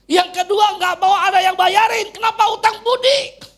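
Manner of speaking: very high, loud preaching shout; shouted declaration. Real, not acted. Preacher declaring his second condition to congregation, shouted declaration. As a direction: hurl out the condition at full volume.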